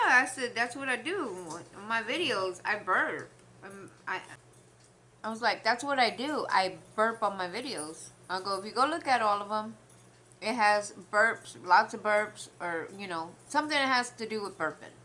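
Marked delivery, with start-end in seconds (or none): 0.5-3.3 emphatic. Retold or imitated. Proud retold reply. 5.6-14.9 emphatic. Retold or imitated. Proud retold explanation.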